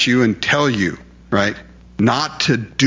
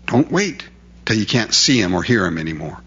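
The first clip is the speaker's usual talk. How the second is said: ordinary delivery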